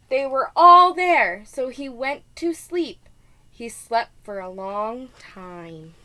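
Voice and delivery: ordinary delivery, nothing marked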